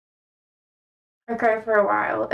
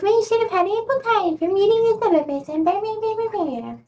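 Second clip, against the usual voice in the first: high-pitched mocking whine of an excuse; mocking imitation; 9 semitones higher than usual. Annoyed student parroting the book sellers' excuse, mocking. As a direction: mimic them in a high, sing-song blah-blah.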